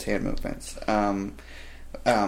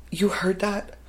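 high, panicky imitation of a flustered kid; flustered apology; 9 semitones higher than usual. Imitating the employee who misgendered him, panicky and high. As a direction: squeak out the apology in a rush of panic.

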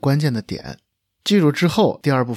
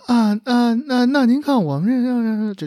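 high, slow eunuch-like voice; squeaky imitation of Jackie; shrill palace servant; 11 semitones higher, slower than usual. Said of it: "我感觉把我学了个太监似的".

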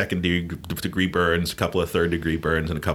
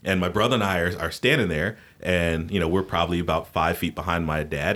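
ordinary delivery, nothing marked

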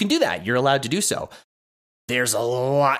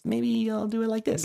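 much higher, nasally character voice; 12 semitones higher than usual. Shown as a demonstration. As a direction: squeeze it up high and nasal. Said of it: "high-pitched nasally voice".

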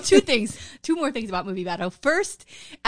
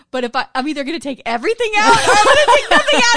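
laughing quote; 10 semitones higher, 10 dB louder than usual. Retold or imitated. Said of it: "he goes".

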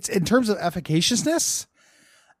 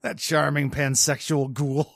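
[name] impression; 3 semitones lower, 4 dB quieter, slower than usual.

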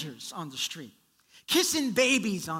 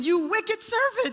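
angry rebuke; 11 semitones higher, 4 dB louder than usual. Acted. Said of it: "he said".

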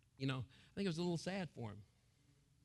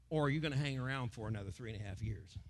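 ordinary delivery

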